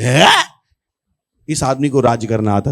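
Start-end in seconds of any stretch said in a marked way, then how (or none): 0.0-0.6 loud rising "hain?"; incredulous reaction. Retold or imitated. Soldiers reacting to the boy's boast, mock disbelief. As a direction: blurt out a startled huh.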